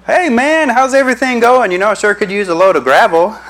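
high, eager fake-friendly voice; butter up the boss asking for gravel; sucking up to the boss. Employee sweet-talking the supervisor, high and eager.

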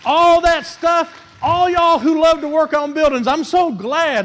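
loud, high, rallying call to the workers; preacher calling out to the congregation; fired-up exhortation. Real, not acted. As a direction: lift the room with it.

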